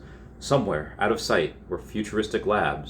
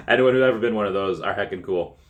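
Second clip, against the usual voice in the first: normal talking voice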